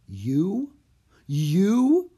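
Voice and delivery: rising, drawn-out disbelief; breathed in amazement. A narrator's reading. Annie learning he is Houdini, breathed in amazement. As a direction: let the question climb, astonished. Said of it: "breathed Annie".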